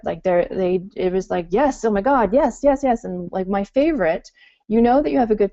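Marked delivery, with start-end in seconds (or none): 1.5-3.0 rising, louder run of eager yeses; mock a thrilled buyer saying yes, building up; eager customer yes. Founder imitating thrilled customers agreeing to prepay, excited. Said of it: "they were all over it".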